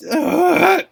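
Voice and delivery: high, loud "Ah!"; short squeal of disbelief; excited scream. Real, not acted. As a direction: let out a loud, high shriek. Finds out she was right.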